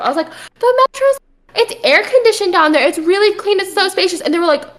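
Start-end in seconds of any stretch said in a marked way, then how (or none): 0.6-4.2 high-pitched, defensive upbeat pitch; trying to be positive. Retold or imitated. Freshman defending the Metro to teammates, trying to be positive. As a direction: retell selling it hard, voice lifted well up. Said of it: "I was trying to be positive".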